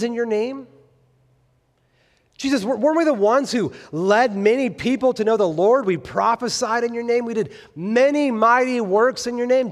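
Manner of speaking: high, loud, insistent self-justifying boast; boastful pleading. Acted. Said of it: "they seem to boast of great religious experience".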